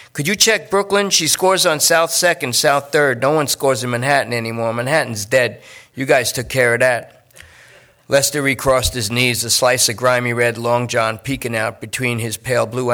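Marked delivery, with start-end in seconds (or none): 0.1-7.1 pleading junkie. Acted. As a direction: push them to check, nervous and needy. Lester pushes Brooklyn search.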